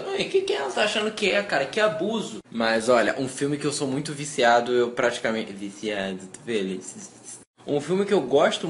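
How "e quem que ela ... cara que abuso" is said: mock outrage. Still mock offended by comment.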